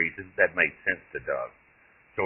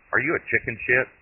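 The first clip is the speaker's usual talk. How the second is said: higher, mocking jab; taunting; 8 semitones higher than usual. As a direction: needle him with a cackling dare. Foul-mouthed great-grandmother taunts Doug.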